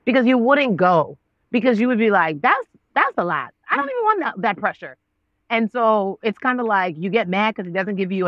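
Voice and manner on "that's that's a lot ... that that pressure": mock protest. Refusing God's big plans, mock protest. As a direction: balk at it, pitch jumping high midway.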